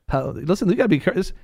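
usual speaking voice

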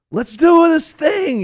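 high, loud, slow holler; rallying call. Retold or imitated. People looking around, ready to go, hollering. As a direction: call it out long and eagerly. Voicing eager shout to get going.

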